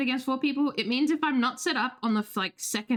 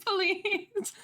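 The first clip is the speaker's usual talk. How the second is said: mock pleading; 8 semitones higher than usual. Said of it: "I was crying in the club 'cause I was like".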